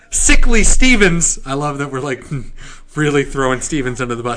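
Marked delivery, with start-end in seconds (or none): 0.1-1.4 high, loud newsboy hawking headlines; old-timey paperboy crying the news; newspaper kid. Pretend. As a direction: shout the headline like a street newsie. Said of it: "the newspaper voice".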